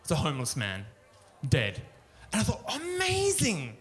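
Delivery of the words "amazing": stretched, high sarcastic "Amazing!"; mock amazed. Comic seeing a dead body in New York, mock wonder. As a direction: fake thrill, drawn out slowly.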